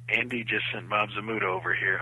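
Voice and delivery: low, soft word to the director; confidential aside. Retold or imitated. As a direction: lean in and report it discreetly. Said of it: "I leaned down and I said".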